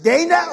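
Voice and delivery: "Dana" said DAY-nuh; first syllable of "Dana" rhymes with "day"; long-A Dana. Shown as a demonstration. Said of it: "nobody knows how to say her first name".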